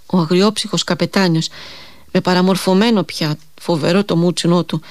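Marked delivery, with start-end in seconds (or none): none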